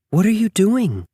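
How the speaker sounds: high, gently puzzled mother bear; a bear mother puzzled by her pup. A narrator's reading. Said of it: "she asked".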